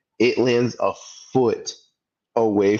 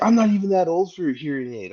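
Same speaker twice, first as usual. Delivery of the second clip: high, indignant mock voice of the dad; offended old man; 10 semitones higher than usual. Mocking the golfer who was told to get a hearing aid, high indignant whine. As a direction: squeak it out like an offended old guy. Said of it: "he's like".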